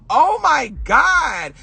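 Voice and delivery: high, drawn-out dismay; hammed-up stunned exclamation; retold shock. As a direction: stretch it out high, overwhelmed. Retelling own shock.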